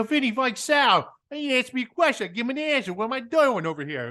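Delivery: high, indignant Jersey private; mock Jersey complaint; Jersey protest. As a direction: plead your case in a thick Jersey accent.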